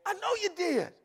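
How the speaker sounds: high, loud shouted preaching with laughter; preaching at full force. Real, not acted. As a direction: whip the congregation up to the climax. Preaching climax.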